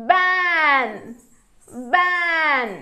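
"బ్యాన్" loud, long vowel, falling; pronunciation demo. Shown as a demonstration. Teacher modelling the blended word, "బ్యాన్" loud and drawn out. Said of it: "ఎలా పలుకుతాయో చూద్దాము".

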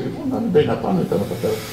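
usual speaking voice

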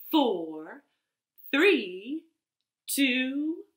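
spaced count, each number rising; rocket countdown. Pretend. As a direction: build suspense towards lift-off.

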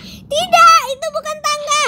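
high panicked refusal. Pretend. Refusing the lava stairs, high panicked no. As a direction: cry out in protest.

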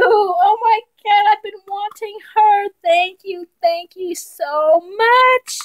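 high, loud, thrilled voice; excited gushing. Real, not acted. Thanking the sender, thrilled, in a high excited voice. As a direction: gush with overflowing joy. Overjoyed by gift.

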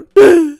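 loud, high gasp; shocked gasp. Acted. Villager gasps on hearing a house must be bought, startled. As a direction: gasp at the price.

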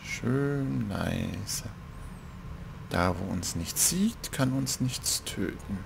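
a slow, very soft sneaking murmur; hushed sneaking. Pretend. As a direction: creep along, keep it hushed. Sneaking in the game.

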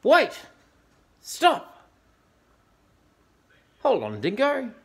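high, loud calls to halt; urgent shout. Acted. Animals stop Dingo cooking the wombat, shouting. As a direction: shout to stop it right now.

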